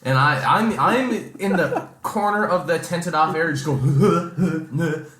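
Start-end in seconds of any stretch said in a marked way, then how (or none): none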